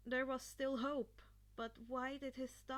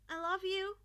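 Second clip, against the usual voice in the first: higher, louder I love you; loving goodbye; 5 semitones higher, 8 dB louder than usual. A narrator's reading. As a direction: send him off with love. Mother's goodbye.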